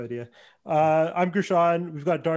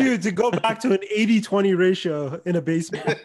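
laughing; 5 semitones higher, 4 dB louder than usual. Real, not acted. Laughing while joking about parties.

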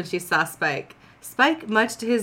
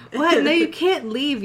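a mild read-aloud yell of protest; 7 semitones higher than usual. A narrator's reading. Dawn yells at Spike not to leave, mildly raised. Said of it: "yelled Dawn".

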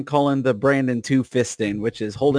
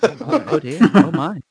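prim pearl-clutching; 3 semitones higher than usual. Pretend. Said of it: "I just love [name]'s "oh dears".

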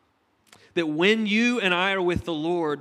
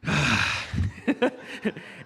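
long breathy sigh of patience running out; drawn-out weary exhale; exasperated sigh. Retold or imitated. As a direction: sigh at the friend's jokes. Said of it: "I would just go".

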